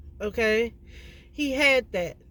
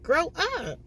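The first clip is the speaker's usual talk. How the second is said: higher, insistent tough-love scolding; blunt scolding; 8 semitones higher than usual. Real, not acted. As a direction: scold him like he needs to hear it, pitched up high. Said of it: "I'm saying it like this 'cause some people need to hear it".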